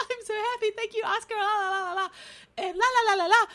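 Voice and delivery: very high giggly mock acceptance speech; gushing winner's speech. Host mimics winners giving their speech, mock giddy gushing. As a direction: squeal happy thanks and babble la-la-las. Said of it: "they were upset in their speech".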